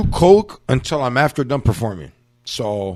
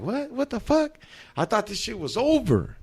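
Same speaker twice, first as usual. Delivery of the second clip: shocked crowd; 9 semitones higher, 6 dB quieter than usual. Retold or imitated. Crowd reacts to surprise act.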